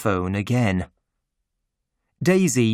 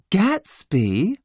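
put-on higher curious young woman; a hint of a puzzled Daisy; Daisy's voice; 7 semitones higher than usual. A narrator's reading.

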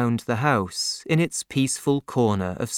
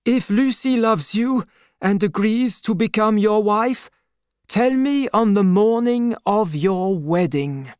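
put-on higher-pitched, kindly old father; old doctor's voice; 9 semitones higher, 3 dB louder than usual. A narrator's reading. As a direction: raise the voice for the aging doctor. Doctor speaking.

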